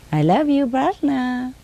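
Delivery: high sing-song love declaration; parrot's affectionate mimicry. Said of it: "She say like that".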